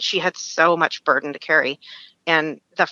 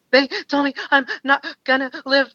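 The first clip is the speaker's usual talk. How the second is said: much higher, gasping between words; imitated breathless crying; 9 semitones higher than usual. Newly diagnosed caller telling her best friend, imitated breathless sobbing. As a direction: act out sobbing too hard to catch breath. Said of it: "you can't even catch your breath".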